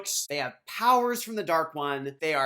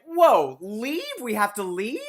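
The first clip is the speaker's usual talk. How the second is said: surprised; 6 semitones higher, 7 dB louder than usual. Retold or imitated. Rand stunned at leaving home.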